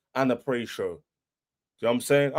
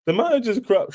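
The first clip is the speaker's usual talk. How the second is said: higher, whiny mock rival fan voice; mocking the haters; 8 semitones higher than usual. Fan mimicking rival haters with a whiny mock voice. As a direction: sneer their words back in a silly voice. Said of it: "When everyone was like".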